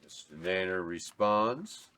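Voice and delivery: normal talking voice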